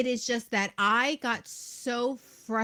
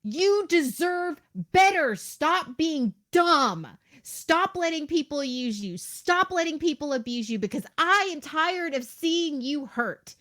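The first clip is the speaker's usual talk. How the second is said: higher, louder exasperated scolding; frustrated plea; 5 semitones higher, 4 dB louder than usual. Retold or imitated. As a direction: beg them to stop, voice raised. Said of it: "may on the outside sound like anger".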